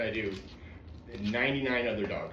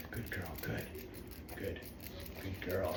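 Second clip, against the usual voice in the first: soft praise; 5 semitones lower, 13 dB quieter than usual. Real, not acted. Trainer calming a terrified dog, soft praise. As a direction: soothe the scared dog with gentle praise.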